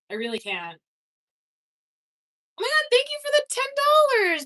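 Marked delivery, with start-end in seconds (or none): 2.6-4.5 much higher, louder squeal of thanks; excited thank you. Real, not acted. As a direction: gush over the donation. Thanking a donor on stream.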